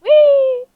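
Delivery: short high yelp while falling; screaming while falling. Real, not acted. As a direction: yelp as you drop. Player falling in the game. (düşerken kısa çığlık)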